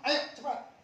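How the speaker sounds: put-on father yelling at his late child; impatient shout. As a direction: hurry the kid up with a loud snap. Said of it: "dia bilang".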